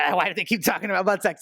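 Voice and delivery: put-on grumpy old boomer grumble; lightly voiced crotchety old man. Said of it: "That's a very boomer voice".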